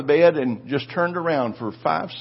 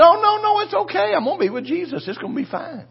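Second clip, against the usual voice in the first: reassuring wife. Retold or imitated. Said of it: "She said".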